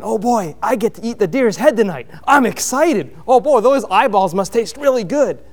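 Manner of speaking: sarcastic mock glee at eating eyeballs; mock excitement. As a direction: play a giddy hunter drooling over the deer's head. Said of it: "you're not excited about going".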